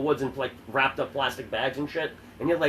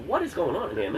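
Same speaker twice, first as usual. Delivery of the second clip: higher-pitched mock bewilderment; incredulous question; 5 semitones higher than usual. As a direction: act out walking in baffled. Retelling his shocked reaction.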